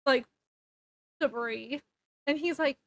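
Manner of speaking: plain reading voice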